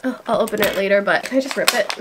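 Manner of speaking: ordinary delivery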